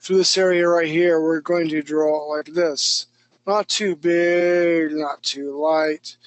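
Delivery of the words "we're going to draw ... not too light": drawn out, slow instruction; slow and careful. Shown as a demonstration. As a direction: stretch each word while demonstrating. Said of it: "teacher shows a careful stroke".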